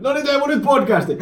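high, loud call to get him recording; playful loud shout across the office; mock call out. Mock calling out colleague.

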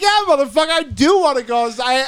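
loud, very high-pitched retort; defiantly eager. Retold or imitated. Inmate taking up guard's dare, defiantly eager. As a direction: jump at the challenge, all worked up.

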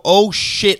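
mock alarmed oh shit. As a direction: blurt it as if just remembering the knife. Caught with knife at airport.

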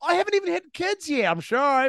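high, fast mock pleading; pleading patient. Patient protesting to the doctors, high fast mock pleading. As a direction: beg in a rush. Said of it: "And you're like".

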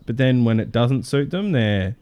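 normal talking voice

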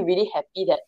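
usual speaking voice

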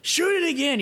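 high, urgent shouted order; retold urgent yell. Marty in the water ordering Terrence to fire, high yell.

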